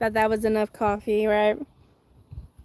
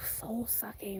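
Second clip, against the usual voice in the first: whispered aside; 2 semitones lower, 17 dB quieter, much slower than usual. Real, not acted. Vlogger whispering about draining negative people. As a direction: drop to a breathy whisper for the insult.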